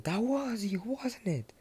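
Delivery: a high, sly mom voice dropping to a whisper; suspicious mom. Retold or imitated. Mother on the phone, catching her son out, high and hushed.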